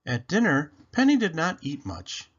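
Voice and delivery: usual speaking voice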